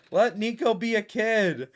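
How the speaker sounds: loud playful yell; yelling a demand at the game; shouting at the game. Real, not acted. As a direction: yell it at the screen. Yelling at the game's character.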